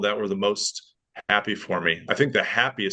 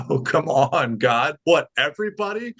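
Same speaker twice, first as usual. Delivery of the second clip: exasperated; 5 semitones higher than usual. Retold or imitated. Exasperated at another question.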